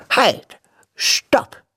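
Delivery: high, whispery interruption; old witch. A narrator's reading. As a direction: cut in sharply like an old witch. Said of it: "sagte die alte Frau". (hoher, hauchiger Einwurf der alten Hexe)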